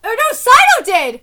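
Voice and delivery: sudden loud realization; alarmed exclamation. Real, not acted. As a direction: blurt it out in dismay. Alarmed reaction to the plot.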